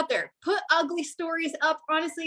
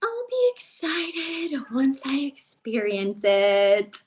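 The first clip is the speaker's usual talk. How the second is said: mock hesitant; much slower than usual. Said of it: "Some of y'all out there thinking".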